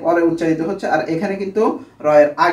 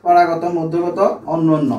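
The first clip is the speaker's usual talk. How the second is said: slow list; slower than usual. Shown as a demonstration. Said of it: "এটা হচ্ছে চার প্রকার".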